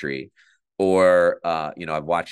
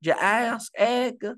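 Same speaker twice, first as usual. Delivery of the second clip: high, slow South Carolina drawl; South Carolina accent; 13 semitones higher, much slower than usual. Retold or imitated. Offering some sauce in a high, slow South Carolina accent. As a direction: stretch it out in a high Southern twang. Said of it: "different part of South Carolina".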